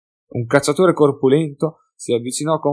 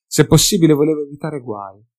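ordinary delivery, nothing marked

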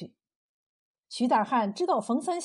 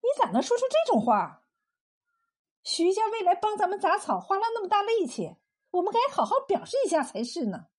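high-pitched indignant wife; offended, protesting; huffy; 8 semitones higher than usual. A narrator's reading. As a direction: scold him in a raised pitch. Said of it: "气呼呼地说".

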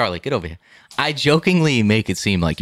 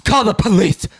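higher-pitched exaggerated alarm; hammed-up cry for help; mock panic; 8 semitones higher than usual. Son mocking the idea he panicked over dad's bike ride, mock panic. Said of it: "I don't remember being like".

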